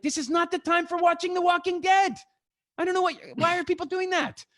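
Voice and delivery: high, loud, incredulous; exasperated outburst. Real, not acted. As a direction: throw up your hands. Exasperated rant about TV.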